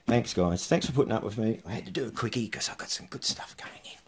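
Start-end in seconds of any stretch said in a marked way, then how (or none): 1.7-4.0 soft whisper aside. Real, not acted. Explaining the quick video to viewers, whispered.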